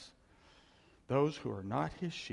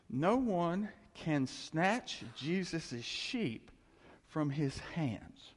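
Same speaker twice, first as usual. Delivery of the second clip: slow solemn scripture reading; much slower than usual. Acted. Preacher reading scripture emphatically.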